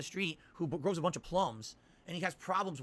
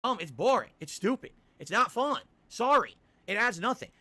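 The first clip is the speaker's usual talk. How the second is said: loud exasperated rant; 5 semitones higher, 8 dB louder, slower than usual. Real, not acted. Ranting about dull side quests.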